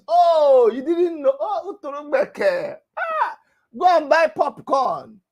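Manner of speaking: loud sneering mock quote, high; mocking taunt. Quoting critics with a loud sneering jeer. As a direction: jeer it like a heckler.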